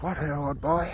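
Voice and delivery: lightly voiced old-school gent; posh 1920s eccentric. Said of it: "nineteen twenties eccentric".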